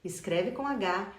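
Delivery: usual speaking voice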